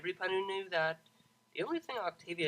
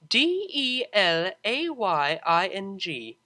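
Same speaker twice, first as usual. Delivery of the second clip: slow, deliberate letter by letter; spelled out slowly; 4 semitones higher, 9 dB louder, much slower than usual. Acted. Octavia spelling the word for the Pegasus, slow and impatient. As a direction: spell each letter with strained patience.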